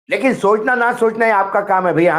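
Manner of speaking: ordinary delivery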